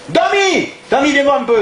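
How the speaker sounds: high, called across the room; calling Domi. Acted. Boss calling his waiter Domi across the restaurant, high call. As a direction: yell the waiter's name from afar.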